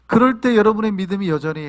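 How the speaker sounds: usual speaking voice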